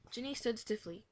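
ordinary delivery

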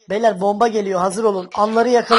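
loud, eager hype to the audience; rally the viewers loudly; excited shout. Real, not acted. Hyping the audience mid-call.

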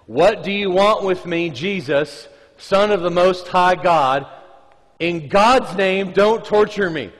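loud, high cry through the possessed man; demon shouting. Acted. Preacher voicing the demon's shout at Jesus, loud and high. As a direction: hurl it out at the top of the lungs. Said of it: "at the top of his lungs".

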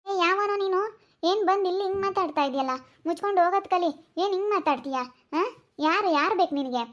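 high, sharp-tongued wife shooing off a stranger; woman's scolding voice. Acted. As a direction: play the annoyed wife telling him to get lost.

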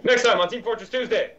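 high, loud show outro; announcer voice. Pretend. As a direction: sign off like a TV host. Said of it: "mock show outro".

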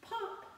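high playful pop; a bright childlike pop; sun popping. Shown as a demonstration. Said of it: "when the sun comes up".

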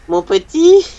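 high, loud cracked granny voice; crazy granny voice. Pretend. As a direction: play a batty grandma. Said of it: "la voix de mamie détraquée". (voix de mamie détraquée)

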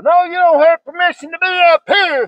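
high, forceful shouted denial; angry contradiction. Real, not acted. Landowner disputing trespassers' permission, angry shouting.

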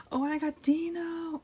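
high, delighted squeal of a reveal; delighted surprise. Real, not acted. Collector pulling a bias wrecker, delighted. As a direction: pitch jumps up in happy surprise.

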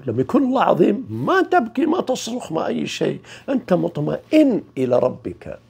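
normal talking voice

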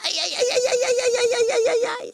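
high wailing ouch ouch ouch; cries of pain. Acted. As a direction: wail it out high like a hurt bug. Louse burns its behind.

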